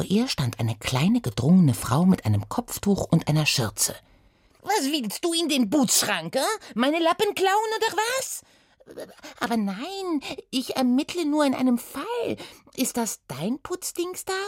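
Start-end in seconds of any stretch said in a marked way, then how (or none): 4.6-8.4 hohe, empörte Putzfrau mit französischem Akzent; französischer Akzent. Acted. 9.4-14.4 hohe Mädchenstimme, unschuldig; erklär dich als kleine Detektivin; Oda, unschuldig. Acted. Oda antwortet.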